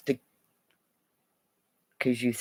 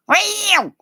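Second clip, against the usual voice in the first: mock cat meow; 5 dB louder than usual. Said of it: "Maybe they'll go".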